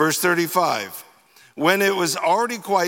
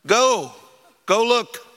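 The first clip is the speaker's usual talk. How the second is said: a louder, higher "Go, go look"; firm command; 5 semitones higher, 4 dB louder than usual. Acted. Jesus sending the disciples to check, firm command. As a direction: insist and send them off. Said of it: "And Jesus says to them".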